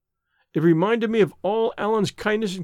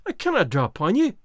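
higher-pitched, appalled Scots protest; mildly raised cry of disbelief; 5 semitones higher than usual. A narrator's reading. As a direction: exclaim it, pleading with a friend. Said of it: "he cried".

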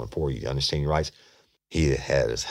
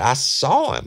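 high, louder retold insistence; excited insistence; 10 semitones higher, 5 dB louder than usual. Suspect insisting to doubting detective, excited. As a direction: insist on it eagerly, pitch leaping. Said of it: "He's so excited".

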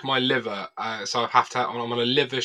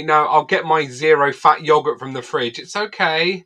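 louder, higher self-satisfied refusal; mock prim refusal; 4 semitones higher, 6 dB louder than usual. Guest mimicking his own dieting refusal, prim and louder. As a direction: turn it down primly. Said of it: "I'm like".

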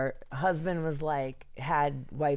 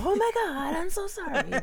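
high squeal, laughing through it; hammed-up sorry, giggling; mock gushing apology; 5 dB louder than usual. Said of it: "Absolutely the first one to go".

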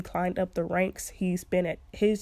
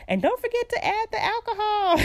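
mom's teasing voice; 14 semitones higher, 5 dB louder than usual. Retold or imitated. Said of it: "And my mom was like".

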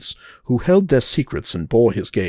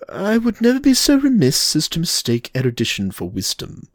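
Reinhardt voice; 7 semitones higher than usual. Acted.

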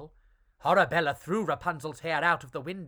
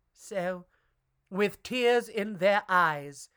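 plain reading voice

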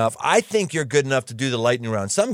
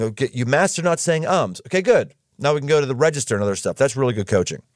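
ordinary delivery

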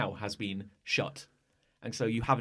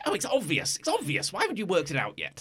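much higher, whining that it's obvious; a petulant piece pitching its voice way up; whiny high voice; 8 semitones higher than usual. Acted. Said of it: "that's not what he sounds like at all".

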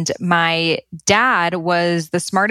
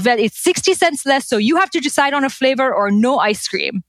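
higher-pitched Persian dad imitation; put-on Iranian father's accent, pitched up; 5 semitones higher than usual. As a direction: lay down the rule in a Persian accent. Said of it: "Your Iranian imitation is spot on".

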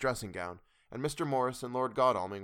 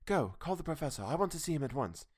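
urgent, higher voice; hurried; 5 semitones higher than usual. A narrator's reading. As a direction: rush him out the door. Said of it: "She said to me hurriedly".

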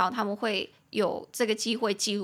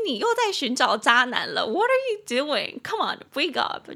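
exasperated; 3 semitones higher, 5 dB louder than usual. Pretend. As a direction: shake her awake with disbelief. Scolding friend about bad men.

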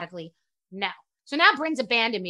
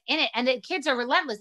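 normal talking voice

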